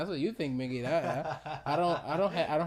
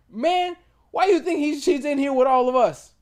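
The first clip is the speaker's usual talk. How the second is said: much higher and louder mocking jab; imitated inmate taunting, pitched up and loud; 12 semitones higher, 10 dB louder than usual. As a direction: tease him in a high, loud put-on voice. Retold guy mocking the other.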